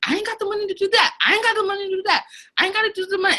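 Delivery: high, fast mock voice; imitating himself. Answering his partner's plans, in a high fast mock voice. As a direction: rattle it off high and quick. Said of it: "I'm like".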